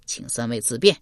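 low, soft-spoken innkeeper turning guests away; quiet, deep dismissal; curt tavern keeper. A narrator's reading. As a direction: keep it low and coolly polite.

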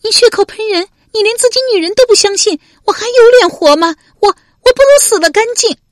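loud, very high hysterical female voice; shrieked, tearful protest of a wronged wife; wailing outburst. A narrator's reading. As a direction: wail your outrage at the top of your pitch. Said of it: "文姜嚎的一声哭了".

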